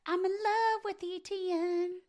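high voice. Pretend. High mocking echo.

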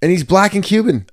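high, incredulous insistence; amused insistence. Real, not acted. Impressionist defending his impression, high-pitched.

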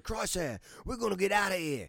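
high, put-on Australian accent. Girl finds Crosshair and wants out, Australian accent. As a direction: plead it in broad high Australian. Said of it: "my Australian accent, by the way".

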